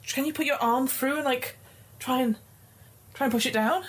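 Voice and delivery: high pleading woman's voice. Acted. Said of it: "she's like".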